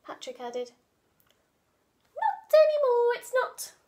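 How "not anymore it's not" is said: smug villain. Acted. Villain boss retort.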